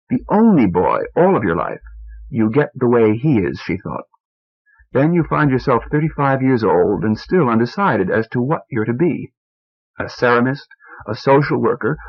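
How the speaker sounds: ordinary delivery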